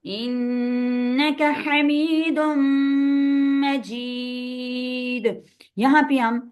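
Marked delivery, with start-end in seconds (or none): 0.0-5.2 very slow, melodic Arabic chant; durood recitation. Shown as a demonstration. Teacher reciting the durood to students, slow chant. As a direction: chant reverently, holding each phrase.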